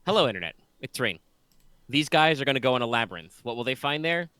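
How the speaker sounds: upbeat show opener, a bit loud; announcer intro. Acted. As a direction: hype up the episode start.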